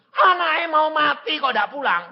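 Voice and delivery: high, wailing neighbour's reproach; hammed-up indignant neighbour; scolding neighbour. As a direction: scold in a high-pitched, scandalised voice. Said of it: "Tetangga bilang gini".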